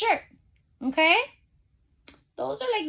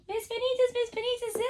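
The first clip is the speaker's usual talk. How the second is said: put-on higher, eager child calling Miss Benitez; mimicking kids calling the teacher; imitating eager girls; 10 semitones higher, 5 dB quieter, faster than usual. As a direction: call the teacher like an excited student. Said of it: "All the girls probably are saying".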